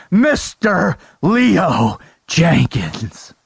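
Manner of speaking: slow, drawn-out ring announcer. Acted. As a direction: stretch each phrase like a boxing MC. Announcer-style guest introduction.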